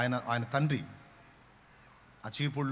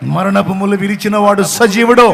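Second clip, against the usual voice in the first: rising to a shouted preaching peak, higher and louder; preaching at full force; 5 semitones higher, 17 dB louder than usual. Acted. Preacher proclaims to the congregation that Jesus lives, full-force shout. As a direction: build to a roaring altar-call climax.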